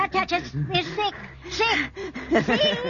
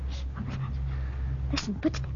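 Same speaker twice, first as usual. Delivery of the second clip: whispering; 5 semitones lower, 9 dB quieter than usual. Acted. Hearing footsteps in the dark.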